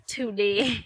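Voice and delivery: incredulous, laughing through it; laughing disbelief. Real, not acted. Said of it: "Of all days".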